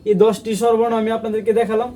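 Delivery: normal talking voice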